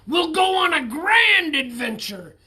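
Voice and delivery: high, loud rousing shout; grand announcement. A narrator's reading. Captain rallying friends, loud rousing shout. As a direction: proclaim it like a rallying cry.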